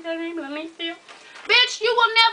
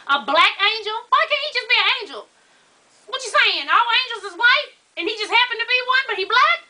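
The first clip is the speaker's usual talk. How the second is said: high-pitched disbelieving questions; baffled, mock-outraged questioning; incredulous; 7 semitones higher than usual. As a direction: challenge it, pitched up and pressing. Challenging the quoted claim, incredulous.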